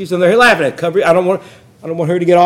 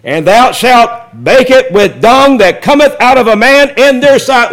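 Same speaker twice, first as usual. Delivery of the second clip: formal reading that gets louder toward the end; scripture reading, rising; 3 semitones higher, 4 dB louder than usual. Acted. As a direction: read it out, building toward the shock. Reads scripture aloud.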